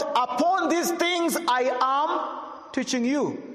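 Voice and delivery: high, slow, drawn-out reading of the verse; solemn scripture reading. Acted. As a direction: lift each word up slowly like holy writ. Preacher quotes scripture aloud.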